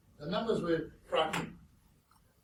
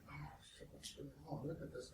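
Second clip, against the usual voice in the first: much softer, muttered dismay; 15 dB quieter than usual. Retold or imitated. Finding errors in the printed book, muttered. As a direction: mutter it as you spot the mistake. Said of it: "and you look at".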